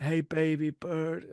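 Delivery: put-on slow New York accent. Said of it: "Now I'm doing just a New York accent".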